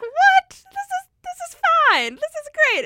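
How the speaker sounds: high, loud indignant disbelief; exaggerated high-pitched protest; excited. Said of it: "And I'm like".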